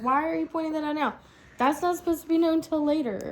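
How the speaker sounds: quick, annoyed complaint; angry. Retold or imitated. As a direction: rattle it off irritated. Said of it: "it made me angry the whole time".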